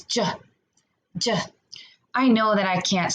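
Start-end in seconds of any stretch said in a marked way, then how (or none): 0.0-1.4 J said short, cut off; isolated j sound. Shown as a demonstration. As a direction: sound out the lone J. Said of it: "listen for the sound that J makes".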